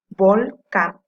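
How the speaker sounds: "volcán" split as "vol", pause, "cán"; syllable by syllable. Shown as a demonstration. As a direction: break "volcán" into two loud syllables. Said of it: "Can es su sílaba tónica".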